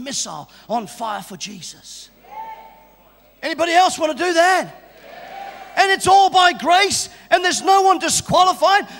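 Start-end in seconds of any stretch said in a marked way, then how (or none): 3.4-8.9 louder, higher rallying preach; fervent altar call. Real, not acted. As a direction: urge everyone to lift hands.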